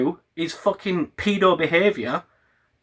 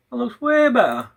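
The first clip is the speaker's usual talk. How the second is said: higher, brighter pitched repeat of the same line; pleased repeat; 6 semitones higher than usual. Real, not acted. Filming himself, admiring the new look, higher pitched. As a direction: say it again, lifted and delighted. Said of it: "repeated differently".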